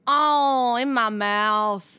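loud, slow greedy moan. Acted. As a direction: moan it out hungrily. Climax of foot bit.

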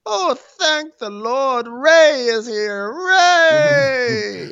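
very high, slow wailing falsetto; mock rapture. Host thanking the Lord for Ray, mock rapturous. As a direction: praise his arrival with hammed-up relief. Mock relief at Ray's arrival.

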